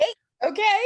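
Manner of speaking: tearful, high, voice breaking; real tears breaking through; crying. Real, not acted. Client weeping after the dog reading.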